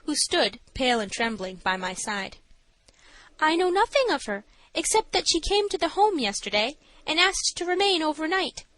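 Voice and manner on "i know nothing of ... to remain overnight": high-pitched matron's testimony; high character voice answering the judge; nervous matron. A narrator's reading. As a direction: explain in a raised voice, as a nervous witness. Voices Missus Stannard.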